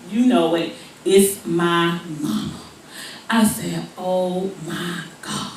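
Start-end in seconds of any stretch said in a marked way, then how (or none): 4.0-5.5 soft, slow oh my God; mock terrified under breath; hushed dread. Girl caught by her mama, hushed.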